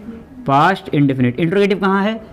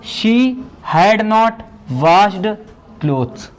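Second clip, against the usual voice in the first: dictating; much slower than usual. Shown as a demonstration. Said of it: "teacher dictating the English sentence".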